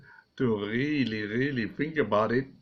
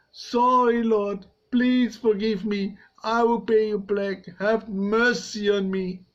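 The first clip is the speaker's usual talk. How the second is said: pleading servant; 8 semitones higher than usual. Acted. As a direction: cry out for mercy. Servant begs again.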